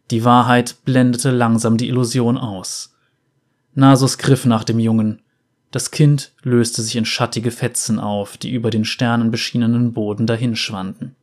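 plain reading voice

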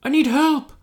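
high desperate plea; high panicked voice. Acted. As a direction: beg frantically in a high voice.

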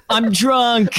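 high, drawn-out imitation of a drunk; put-on drunken holler; mock off-screen drunk yell. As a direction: call it out like a hammy movie drunk. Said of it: "You hear him off screen".

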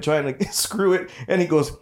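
usual speaking voice

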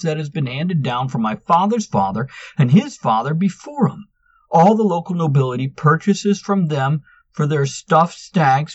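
loud, high, exasperated; fed up and shouting; indignant outburst. A narrator's reading. Manx indignant at gloomy guesses.

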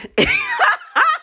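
high, loud laughing shriek; real uncontrollable shriek of laughter. Real, not acted. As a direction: burst out in a squealing laugh. Laughing fit during intro.

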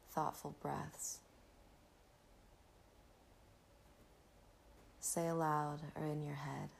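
breathy calm, guiding the listener inward; soft spa whisper. Acted. Leads a gratitude meditation.

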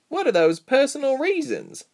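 high-pitched, pressing question; questioning heroine. A narrator's reading. Reshma first to ask the captor, high question.

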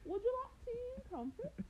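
high-pitched, badly faked British accent; horrible British accent. Joker offering tea and crumpets, horrible mock British accent. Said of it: "I have a horrible British accent right now".

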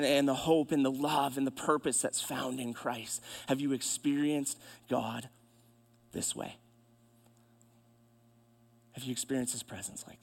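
low, hushed, earnest sermon voice; soft preaching appeal. Real, not acted. Preacher urges church to experience God's presence, soft earnest appeal. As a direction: bring it down to an intimate plea.

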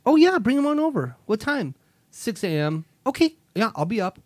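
high, loud, gushing yes; eager wife voice. Retold or imitated. Mimicking his wife gushing yes to the kids, high and eager. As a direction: gush your agreement like a pushover.

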